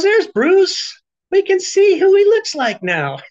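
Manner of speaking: high, loud, delighted teasing; a bright high hostess voice, teasing Bruce; cheery Colette. Retold or imitated. Host greeting Bruce on the call, high and loud with delight. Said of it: "Colette goes".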